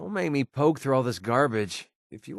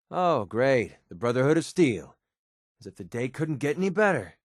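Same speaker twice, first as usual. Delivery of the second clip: normal talking voice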